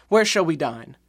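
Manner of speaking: ordinary talk, nothing marked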